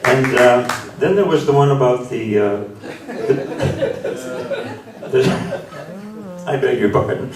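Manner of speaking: real amusement breaking into the speech; laughing. Real, not acted. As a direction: stumble over the words while laughing. Storyteller breaks up while starting next joke.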